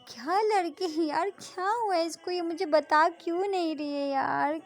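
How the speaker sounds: put-on girl fretting aloud about her silent friend, exasperated; frustrated friend. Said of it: "Radhika होती है वो बोलती है".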